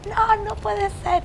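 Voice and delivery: high, slow, despairing wail; retold frantic cry. Coordinator hearing of the killing, crying out in high despair. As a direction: relive the coordinator's panic as the call comes in. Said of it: "empezó a gritar como loca".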